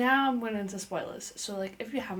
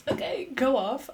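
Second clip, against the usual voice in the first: loud, higher hyped-up "okay, go off"; impressed reaction; 4 semitones higher, 5 dB louder than usual. Retold or imitated. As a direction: play up being impressed, retold. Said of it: "And I'm like".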